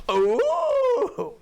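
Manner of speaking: a squeaky, very high, loud ooh of surprise; mock high ooh. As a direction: do Hannah's shocked little ooh, way up high. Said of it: "And Hannah went".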